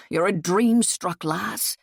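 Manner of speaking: ordinary delivery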